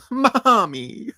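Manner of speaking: playful whimpering cry; little inflection. Pretend. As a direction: whine the word like a needy baby. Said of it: "say it with a little inflection".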